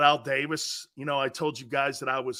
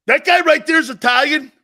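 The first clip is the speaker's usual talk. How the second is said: high, loud imitation of disbelief; astonished grandfather; 11 semitones higher, 12 dB louder than usual. Grandfather learning Franco is Italian, astonished. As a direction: exclaim it as an incredulous old fan.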